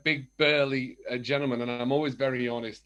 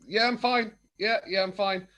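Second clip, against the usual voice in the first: higher-pitched mock reassurance; put-on "fine"; 7 semitones higher, 3 dB louder than usual. Everyone says you're fine, he insists, put-on reassurance. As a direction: brush off concern with a lifted voice. Acting out masking with cheery reply.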